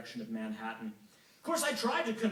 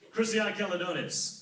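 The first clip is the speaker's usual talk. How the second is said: corrective god voice; 6 semitones higher, 7 dB louder than usual. Acted. Apollo corrects the name.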